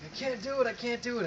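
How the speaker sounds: high, panicked whimper of a soldier losing his nerve; imitating a terrified man losing his nerve; panicky scared soldier. A soldier pinned behind a rock, pitched high in panic. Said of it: "the guy next to him's freaking out".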